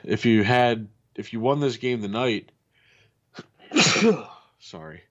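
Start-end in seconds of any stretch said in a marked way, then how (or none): 3.7-4.3 a real sudden sneeze; sneezing. Real, not acted. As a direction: sneeze out loud, caught off guard. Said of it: "excuse me, I have to sneeze".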